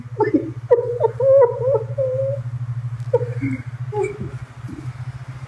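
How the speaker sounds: high laughing half-sob, mock grief; laugh breaking into a fake cry. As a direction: cackle that tips into a hammed-up wail. Laughing while fake crying.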